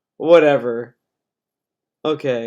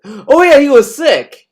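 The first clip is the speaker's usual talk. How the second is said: high, loud, sarcastic "he was sick"; mock sympathy; 9 semitones higher, 5 dB louder than usual. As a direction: fake sympathy with a sneer. Mocks the streamer's excuse.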